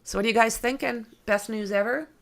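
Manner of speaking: ordinary delivery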